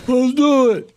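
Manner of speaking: lightly voiced child; imitating son. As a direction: mimic the son's protest. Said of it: "he goes, like".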